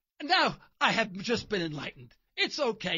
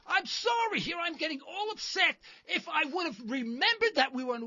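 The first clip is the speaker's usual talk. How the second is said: mock apologetic outburst; 6 semitones higher than usual. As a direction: play it as an over-the-top sarcastic sorry. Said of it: "I said".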